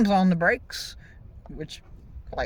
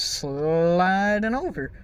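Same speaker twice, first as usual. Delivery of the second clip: "sliding" dragged slowly with rising pitch; drawn-out sliding; much slower than usual. Retold or imitated. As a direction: stretch "sliding" out very long. Drags word to picture slow slide.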